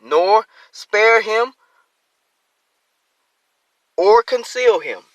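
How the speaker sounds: slow, loud, lifted verse; scripture reading. Acted. Preacher reading the verse slowly and loudly to the congregation. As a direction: draw out each word of the verse.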